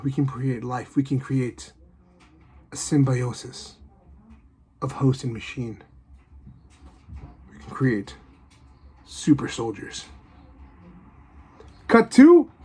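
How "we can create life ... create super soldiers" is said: hushed awe. Retold or imitated. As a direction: let the words sink to a hush. Scientist's awed closing lines.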